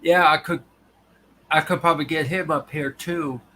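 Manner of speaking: ordinary delivery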